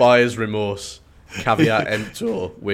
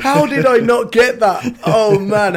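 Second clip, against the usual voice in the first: much higher, loud laughing exclamation; excited laughing; 12 semitones higher, 7 dB louder, slower than usual. Real, not acted. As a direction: cry out in delighted disbelief. Laughing realisation of the joke.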